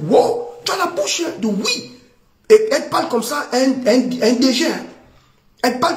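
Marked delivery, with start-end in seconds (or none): 0.1-1.9 mock impressed man. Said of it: "Le monsieur va dire".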